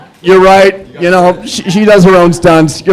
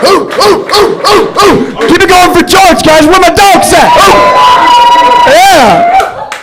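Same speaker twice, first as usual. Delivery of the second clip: hype-man hooting; 11 semitones higher, slower than usual. Acted. Comic hyping the crowd.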